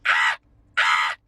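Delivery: low, strangled crow caw; deep dying caw. Retold or imitated. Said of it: "They sound".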